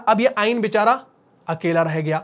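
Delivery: ordinary talk, nothing marked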